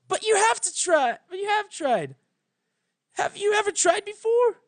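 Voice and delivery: high, insistent pleading; desperate young pony. Acted. Twilight insisting her teacher has never tried. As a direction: push back in desperation.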